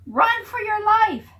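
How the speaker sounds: panicked cry. Acted. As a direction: yell it like the sky is falling. Prairie dogs flee in panic.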